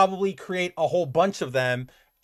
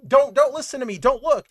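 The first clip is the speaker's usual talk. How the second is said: imitating panicked higher, louder pleading; freaking out; 8 semitones higher, 5 dB louder than usual. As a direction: retell a man's frantic panic, pitched up and loud. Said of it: "like, freaking out".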